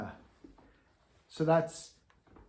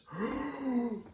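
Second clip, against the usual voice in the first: big dramatic gasp; 12 semitones higher than usual. Shown as a demonstration. Teacher shows students the wrong way to breathe, a big theatrical gasp. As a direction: gasp in like a melodrama. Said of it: "don't do this".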